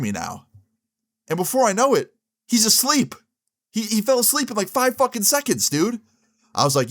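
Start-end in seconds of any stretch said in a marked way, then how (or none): 1.3-6.0 incredulous. Real, not acted. Disbelief telling he fell asleep.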